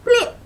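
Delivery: very high squeaky blip for the hit; high loud cartoon blip; punch sound. A narrator's reading. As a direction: pop out the punch noise.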